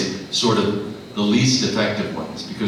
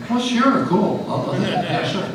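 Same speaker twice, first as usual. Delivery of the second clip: high, breezy agreeable voice; eager yes; 11 semitones higher than usual. Retold or imitated. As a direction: toss off a cheerful yes, pitched up. Imitating his eager self.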